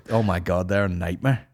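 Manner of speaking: ordinary talk, nothing marked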